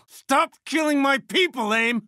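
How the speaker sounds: high, drawn-out cry at the enemy; anguished protest. Acted. Commander to the enemy killing their people, anguished protest. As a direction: cry out slowly, pitch way up. Pleading as people are killed.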